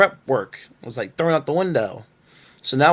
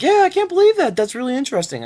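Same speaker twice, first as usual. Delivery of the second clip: much higher, louder, gushing mock interviewer; mocking fake enthusiasm; 13 semitones higher, 4 dB louder than usual. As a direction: ham up her fake interest in a squeaky voice.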